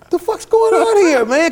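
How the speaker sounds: high, loud, amazed outburst; astonished outburst. Retold or imitated. Band on stage stunned by the roaring crowd, loud high amazement. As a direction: blurt it out in loud disbelief.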